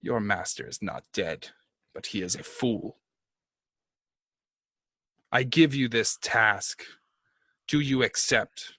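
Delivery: measured, solemn decree with pauses; imperious dark lord. Acted. Posing as a tyrant god offering a servant redemption, solemn. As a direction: hand down a task as a god would.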